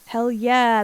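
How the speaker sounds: hearty, punched-up cheer; emphatic approval. Real, not acted. Streamer heartily backs a chat message, emphatic approval. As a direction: cheer it with full conviction. Approving again with more force.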